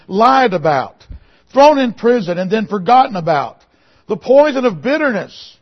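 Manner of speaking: loud, rising sermon cadence, pounding each phrase; preaching at full force. Acted. Preacher to his congregation about Joseph, preaching at full force. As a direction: preach it out over the congregation, building.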